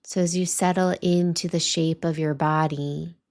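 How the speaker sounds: calm meditation voice with long pauses; guided meditation. Acted. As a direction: guide listeners gently, leaving space between phrases.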